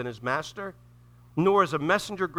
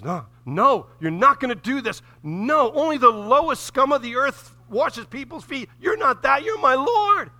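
loud, rising protest, climbing high at the end; Peter protesting; 9 semitones higher, 8 dB louder than usual. Retold or imitated. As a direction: refuse him, getting louder and higher. Peter protests Jesus washing feet.

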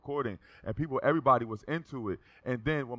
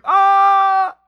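very loud, high sustained "Ah!"; re-enacted train-car scream; full-throated scream; 16 dB louder than usual. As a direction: scream at the top of your lungs. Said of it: "So I screamed".

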